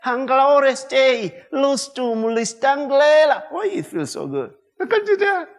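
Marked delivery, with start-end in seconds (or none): 0.1-3.4 slow, easy tongues from within; slow spirit tongues. Shown as a demonstration. Pastor shows the right way to pray in tongues, slow and easy. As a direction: let it flow out slowly from the spirit. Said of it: "you can do it right from your spirit".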